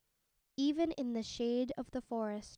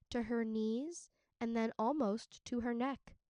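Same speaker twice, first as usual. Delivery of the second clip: plain reading voice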